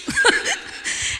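a breathy high giggle; real high giggle; high airy laugh. Real, not acted. Laughing after admitting defeat.

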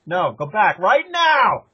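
slow, high, imitated scolding command; mock-furious insistence, stretched out; retold stern order. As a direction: mimic an irate wife, drawing out each word. Acted wife ordering husband back.

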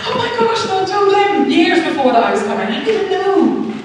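high, loud, wondering; amazed that God told him first; overwhelmed amazement. Real, not acted. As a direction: still amazed, voice lifted high. Reliving the amazing news.

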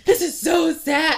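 high, loud, slow mock-sad whine; hammed-up grandma lament; imitating grandma. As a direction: drag it out like a dramatic grandma. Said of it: "She was like".

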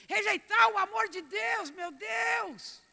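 high, loud anguished exclamation; anguished outcry. Real, not acted. Pastor crying out to the church in anguish. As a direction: cry out in disbelief.

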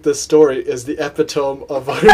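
"epitome" as EP-ih-tome, laugh creeping in; "epitome" ending like "tome"; mispronounced epitome. Retold or imitated. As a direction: quote the friend's "epitome" with a silent final E, amused. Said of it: "she said it, like, four or five times".

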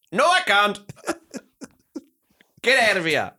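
mock indignant refusal. Telling the late buyer to go, mock indignant. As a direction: refuse him in hammed-up indignation.

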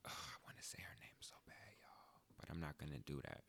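hushed whisper to the crowd. Real, not acted. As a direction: whisper it like a secret. Aside to audience, secret name.

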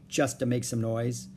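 plain reading voice

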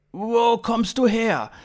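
high, loud, slow question; careful questioning. Acted. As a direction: address a foreigner slowly and loudly. Slowly questioning the alien.

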